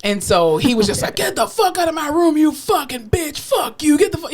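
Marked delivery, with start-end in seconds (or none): 1.2-4.3 high-pitched imitation of an irate patient; retold tirade, raised in pitch rather than volume; cussing out. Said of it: "really cussed me out".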